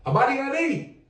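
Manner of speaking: high ringing call of Habari Gani; call-out greeting. Shown as a demonstration. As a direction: call it out to the room and lead the response. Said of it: "put some more emphasis in that".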